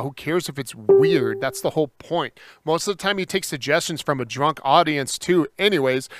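ordinary delivery

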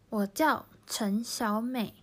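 我叫陳小美 said slowly, each tone clear; slow Chinese model. Shown as a demonstration. Teacher modelling Ms A's line, slow Chinese. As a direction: read 我叫陳小美 slowly, syllable by syllable. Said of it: "その後のAさん".

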